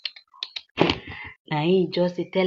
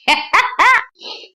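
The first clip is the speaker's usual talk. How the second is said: loud, high cackling laugh; amused laugh; 4 dB louder than usual. Real, not acted. Storyteller laughing at the love confession, high and amused. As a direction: burst out laughing, high-pitched.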